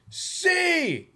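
high excited cheer; kid's cheer. Retold or imitated. Dad imitating his son cheering on his shoulders. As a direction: yell it like a seven-year-old above the crowd. Son shouting over the crowd.

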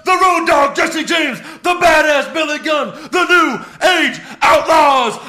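shouted, high ring announcer; grand entrance announcement. Retold or imitated. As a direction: belt out the team names. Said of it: "I can't believe I remembered all that".

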